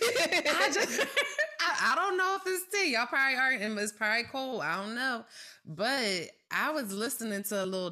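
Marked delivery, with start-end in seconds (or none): 0.0-5.2 giggly, high, about to spill tea; laughing gossip voice. Real, not acted. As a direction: laugh through the gossip setup. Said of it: "this is [name] Gossip voice".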